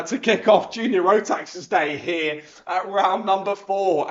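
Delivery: normal talking voice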